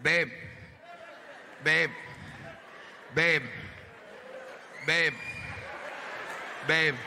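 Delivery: repeated slurred calls; drunk calling. Acted. Drunk boyfriend calling his girlfriend babe. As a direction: keep calling her, drunk and needy.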